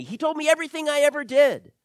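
high, loud, rushing exclamation; excited testimony. Acted. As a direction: blurt out the amazing news to everyone. Woman's testimony, acted excitedly.

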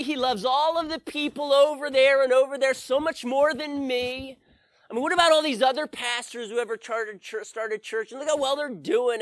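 hammed-up whiny self-pity, high and loud; mock pity party. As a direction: whine like a sulking child. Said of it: "I don't throw pity parties".

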